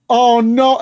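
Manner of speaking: high, loud retold cry of dismay; alarmed cry. Host retelling finding the dog flat on her back, alarmed. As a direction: re-enact a loud moment of panic. Acts out own alarm in story.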